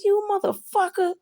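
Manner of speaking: high-pitched indignant cursing; playfully scandalized outburst; mock outrage. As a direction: reenact being outraged at a prank. Retold outburst at laughing partner.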